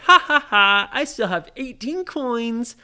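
loud mock ha-ha, high boast; gloating laugh. Ryan taunts his friends, laughing and gloating. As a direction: laugh it out loudly, then crow. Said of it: "Ryan laughed and he said".

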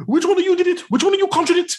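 put-on weirdly French accent, pitched very high; exaggerated guttural French impression; Barty Crouch Senior. Podcaster mocking the father's speech, weirdly French accent. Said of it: "it's, like, also weirdly French".

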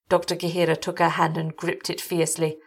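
plain reading voice

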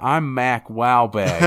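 slow, drawn-out mock Boston accent, sounding almost Australian. Friend at a podcast hams up a Boston accent. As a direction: stretch it out in an exaggerated Boston drawl. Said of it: "You sound Australian".

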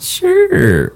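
very high, drawn-out reply; put-on agreeableness. Answering a stranger who asks to talk, falsely agreeable. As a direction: sing it out high, hiding your doubts. Feigned agreement, unlike inner thought.

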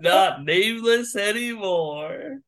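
high, drawn-out taunt; slow, high-pitched crowing; gloating singsong tease. Real, not acted. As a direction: stretch it slowly and rub it in, pitched high. Gloating over the slipped name.

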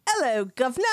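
hammed-up British "'ello, guv'nor"; mock Cockney, played for laughs; fake Cockney accent. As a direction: do a deliberately bad Cockney greeting. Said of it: "For me, it would be like".

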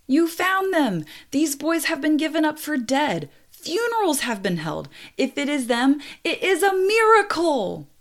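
high, loud, choked-up relief; tearful operator. Retold or imitated. Radio operator telling Peter the boys are alive, high, tearful relief. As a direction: cry it out like news too good to believe. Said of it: "a very tearful operator came on the radio".

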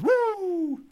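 high wrestler's woo yell; Ric Flair woo. Retold or imitated. Retelling the gym run-in, imitating the wrestler's signature high woo. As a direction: let out the famous wrestling whoop, way up high. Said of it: "the guy goes".

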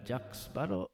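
put-on high, lilting Jack Sparrow pirate accent; quick mock Jack Sparrow pirate accent. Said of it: "I don't know what accent that is".

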